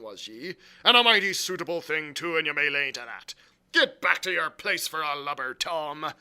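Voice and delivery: loud, scolding sailor voice; scornful ship's cook. Acted. Silver sends Morgan back to his seat, scornful. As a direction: dismiss him loudly with scorn.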